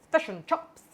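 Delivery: Kiwi vowels, "Fish and chops" with a short e in fish, fesh and chops; New Zealand accent. Retold or imitated. Teasing the New Zealanders with a Kiwi accent. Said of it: "chops for all my New Zealanders".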